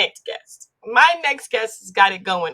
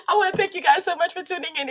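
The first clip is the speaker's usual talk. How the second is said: laughing; 7 semitones higher, faster than usual. Real, not acted. Host laughing after own joke.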